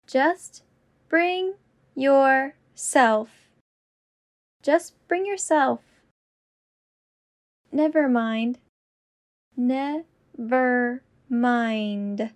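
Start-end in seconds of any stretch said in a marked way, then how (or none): 0.1-3.3 slow, each word apart. Shown as a demonstration. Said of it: "repetition drill, slowed take". 9.6-12.3 very slow and deliberate. Shown as a demonstration. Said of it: "repetition drill, slowed take".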